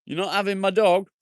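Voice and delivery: high, loud playful protest; mock indignant. Real, not acted. Streamer protecting his dog from a viewer, high mock protest. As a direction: repeat it in a shocked squeal. Repeat, much higher and louder.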